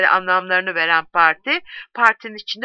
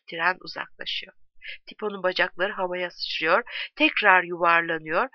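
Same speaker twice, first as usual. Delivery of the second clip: ordinary delivery, nothing marked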